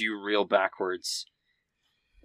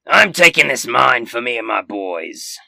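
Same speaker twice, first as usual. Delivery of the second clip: much louder, gruff-sounding orc warrior roaring; orc threat; 2 semitones higher, 11 dB louder than usual. Acted. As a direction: snarl it as the axe comes down. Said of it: "and says".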